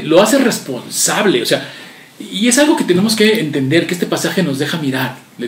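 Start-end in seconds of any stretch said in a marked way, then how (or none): none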